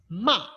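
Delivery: "ma" high and loud, falling; "ma" sharp fourth tone. Shown as a demonstration. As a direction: snap "ma" down. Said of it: "This one you pronounce as".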